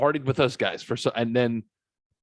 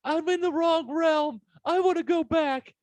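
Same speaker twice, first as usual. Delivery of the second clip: mock panicked plea; 4 dB louder than usual. Tripper begging to leave wrong realm, mock panicked plea. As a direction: plead in an exaggerated falsetto, stretching the last phrases.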